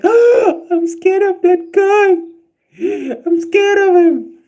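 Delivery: high, slow timid bride; scared bride. Retold or imitated. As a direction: squeal like a scared bride clinging on.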